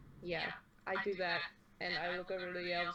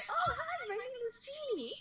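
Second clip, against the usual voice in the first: put-on very high, eager child greeting; little girl voice. Jeannie greeting the goblin, high and eager. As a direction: chirp it like an excited little girl. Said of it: "I shake his hand emphatically and I say".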